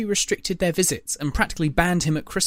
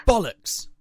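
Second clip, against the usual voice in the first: snapped; 7 semitones higher than usual. A narrator's reading. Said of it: "he snapped".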